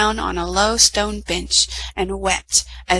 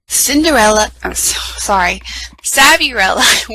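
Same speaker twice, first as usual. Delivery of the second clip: usual speaking voice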